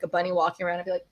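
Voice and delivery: ordinary delivery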